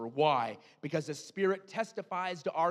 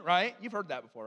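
ordinary talk

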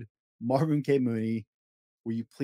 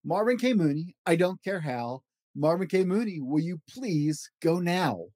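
lively read-aloud of a rhyming picture book; storybook voice, playful and rhythmic; reading to children; 5 semitones higher than usual. Acted. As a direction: bounce through the rhymes for a child at bedtime. Reads Dr. Seuss book aloud.

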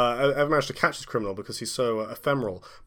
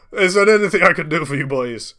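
put-on Scottish accent; 4 semitones higher, 5 dB louder, faster than usual. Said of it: "Wallace Agentman accent".

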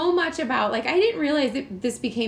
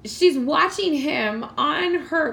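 ordinary talk, nothing marked

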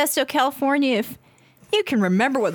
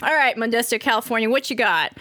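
usual speaking voice

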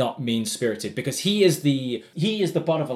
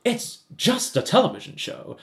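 a touch higher, pleading apology; mildly hammed-up pompous plea; a hint of Frasier begging patience; lightly voiced Frasier; 6 semitones higher than usual. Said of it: "Frasier's like".

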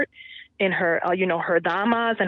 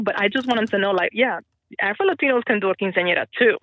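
ordinary delivery

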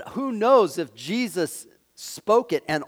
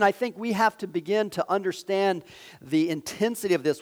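ordinary talk

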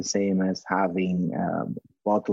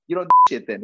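loud, higher teasing jab; mock accusation; 8 semitones higher, 7 dB louder, much faster than usual. Ribbing the customer in the retold chat, loud and high. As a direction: jab him loudly, half laughing.

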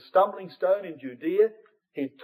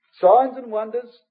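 louder, slow, stress on signs; proclaimed phrase; 5 semitones higher, 7 dB louder, slower than usual. Real, not acted. Preacher to the congregation, slow loud proclamation. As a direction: ring out the phrase as a headline.

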